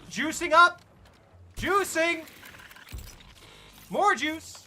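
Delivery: high, loud gleeful cries; excited shouting. Real, not acted. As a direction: cheer yourself back to life.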